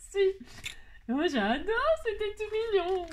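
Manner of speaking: high, thrilled gush over the gift; delighted squeal. Real, not acted. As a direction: squeal with delight at something cute.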